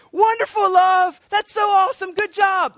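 high, loud, beaming shout; shower the child with joyful praise; enthusiastic praise. Retold or imitated. Father praising his toddler, bursting with enthusiasm. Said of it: "why am I enthusiastic".

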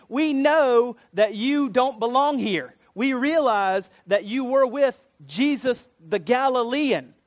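slow, high, loud, word by word; emphatic accusation. Retold or imitated. Bystanders confronting Peter, slow emphatic accusation. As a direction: spell it out insistently for someone playing dumb.

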